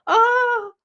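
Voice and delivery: high pained cry of shock; high yelp of pain. Retold or imitated. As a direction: cry out as if just kicked. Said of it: "I'm lying on the floor going".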